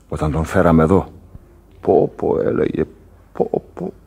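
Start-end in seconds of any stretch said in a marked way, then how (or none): 1.8-3.9 faint breathy imitation of his groan; whispered moan. Sailor imitating the injured man's faint moans, whispered.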